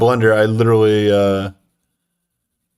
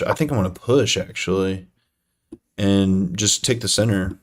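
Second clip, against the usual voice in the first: ordinary talk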